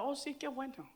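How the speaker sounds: very soft, understated reply; outwardly casual reaction. Retold or imitated. Preacher replying outwardly to mother's news, hushed and understated. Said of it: "por fuera estaba".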